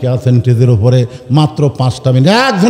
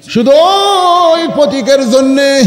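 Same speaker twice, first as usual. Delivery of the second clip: shepherd crying out; 10 semitones higher than usual. Acted. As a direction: stretch the outcry out high. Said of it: "রাখাল চিল্লা বলতেছে".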